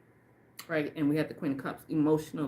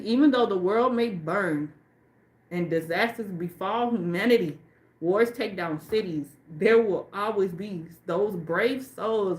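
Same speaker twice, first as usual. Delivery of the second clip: slow, louder, deliberate reading of the card text; solemn reading aloud; 3 semitones higher, 5 dB louder, slower than usual. Acted. Reader reciting the card's prophecy to viewers, slow and solemn. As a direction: read it like a sacred prophecy, slowly.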